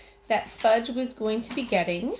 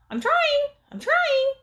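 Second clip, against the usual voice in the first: very high, louder parrot voice; bird mimicking; 5 dB louder, slower than usual. A narrator's reading. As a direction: squawk it back like a mynah. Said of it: "Uncle Feather repeated".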